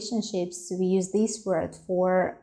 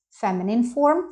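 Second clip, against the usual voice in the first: usual speaking voice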